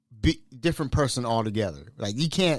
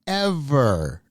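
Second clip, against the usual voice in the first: normal talking voice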